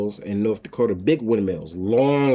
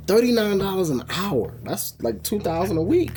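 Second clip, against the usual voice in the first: amazed; 7 semitones higher than usual. Retold or imitated. Quoting recruiter's surprising pay.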